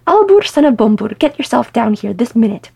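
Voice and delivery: exasperated mother calling across the room; scolding call. A narrator's reading. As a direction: call a naughty child down sharply. Mother calling a naughty child.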